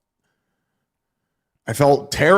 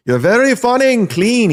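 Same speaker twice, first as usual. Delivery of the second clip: high-pitched mock Russian accent, Yakov-style; a bad Russian accent, pitched well up; 10 semitones higher, 3 dB louder than usual. Comic quoting Yakov in a mock Russian accent. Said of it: "Can't do a Russian accent".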